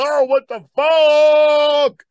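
high, loud, slow shocked cry. Retold or imitated. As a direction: drag it out in horror. Victim's shocked reaction.

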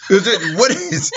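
high laughing disbelief. Real, not acted. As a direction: laugh through it, high and squeaky.

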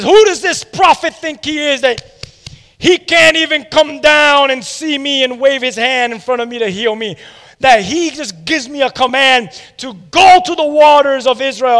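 hammed-up outrage at the prophet; indignant shout. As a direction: Naaman ranting that the prophet won't come down. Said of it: "He gets upset".

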